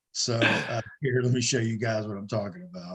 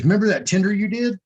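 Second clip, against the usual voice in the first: higher, louder and fast, imitating Andrea's eagerness; eager retold question; 9 semitones higher, 6 dB louder, faster than usual. Andrea asking him over the phone, eager and quick. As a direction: rush it out brightly as she did. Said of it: "She goes".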